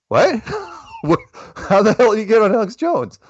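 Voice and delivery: high-pitched, incredulous outburst; retold flabbergasted reaction; astonished. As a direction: act out being floored by the news. Retelling own astonished reaction.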